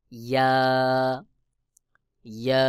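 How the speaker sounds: ইয়া short and clear from the middle of the tongue; letter demo. Shown as a demonstration. Teacher showing students the letter ইয়া. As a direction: say ইয়া crisply. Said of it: "যেমন".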